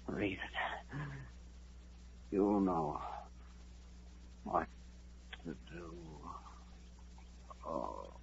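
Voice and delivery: the life draining out word by word; dying breath. Acted. Dying man giving his last words to the Ranger, fading to nothing.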